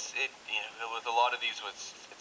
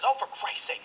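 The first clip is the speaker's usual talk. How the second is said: much higher, sharp angry outburst; angry exasperation; 13 semitones higher, 3 dB louder than usual. Shown as a demonstration. Annoyed speaker venting anger at someone, higher. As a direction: spit it out in anger. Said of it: "saying it in a more angry way".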